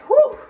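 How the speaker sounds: loud winded whew; breathless exhale. Real, not acted. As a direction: blow out a long tired breath. Short of breath after coming home.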